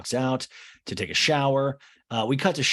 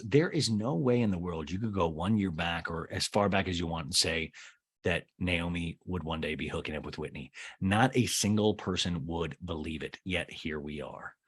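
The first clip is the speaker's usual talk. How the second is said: a hint of mock gravity; mock grave Craig; 5 semitones lower, 6 dB quieter than usual. Craig in a talking head marvelling at the pairing, low grave. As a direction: lower and softer, solemn imitation.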